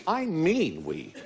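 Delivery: "we" stressed and slowed. Real, not acted. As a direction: lean hard on "we". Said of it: "when I say we".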